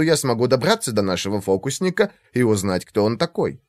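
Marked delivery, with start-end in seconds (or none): none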